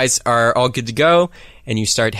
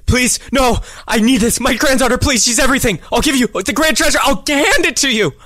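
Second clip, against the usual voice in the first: begging prisoner; 13 semitones higher than usual. Acted. Gagged captive begs the party for the antidote, high and desperate. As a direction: beg for your granddaughter's life. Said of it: "he's like".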